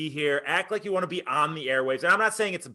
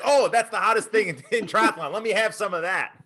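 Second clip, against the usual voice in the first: exaggerated excitement, pitched up; mock excited crowd; 5 semitones higher than usual. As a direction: gush like an eager buyer. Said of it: "everybody's gonna be like".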